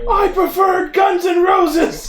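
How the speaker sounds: slow high yelled cry; shouting. Acted. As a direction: bellow it out. Character shouting.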